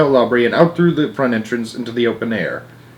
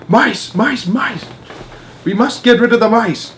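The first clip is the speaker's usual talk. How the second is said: crowd yelling; 10 semitones higher than usual. Acted. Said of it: "And everyone was yelling".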